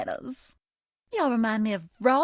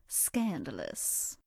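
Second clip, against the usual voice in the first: sultry hush; 9 semitones lower, 8 dB quieter, much slower than usual. Acted. Teasing secret gift.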